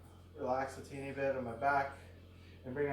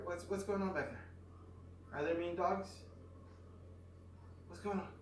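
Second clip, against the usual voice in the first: talking to the dog; 3 semitones higher, 8 dB quieter than usual. Real, not acted.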